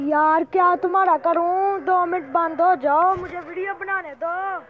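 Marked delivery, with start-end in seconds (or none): 0.0-3.1 exasperated. Real, not acted. Scolding noisy vendor.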